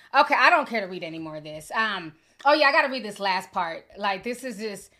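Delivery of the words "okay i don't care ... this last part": fast, louder exasperated; loud, fast fed-up aside; fed-up outburst. Real, not acted. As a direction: done with it, push through. Fed up with reading texts.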